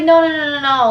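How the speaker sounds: imitating the boy loudly denying it; boy protesting. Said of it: "And he's like".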